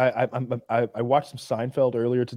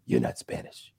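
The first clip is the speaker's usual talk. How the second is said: hushed, much lower action-star imitation; Steven Seagal; 8 dB quieter than usual. Imitating the star accusing a guy, low and hushed. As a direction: drop into a soft, low mock tough-guy voice. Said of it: "one scene where he goes from".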